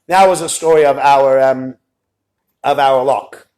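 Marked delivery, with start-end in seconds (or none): none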